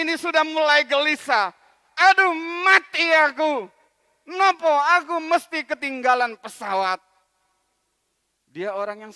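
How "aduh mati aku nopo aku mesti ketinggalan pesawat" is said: panicked worry. Acted. Worried man stuck in traffic.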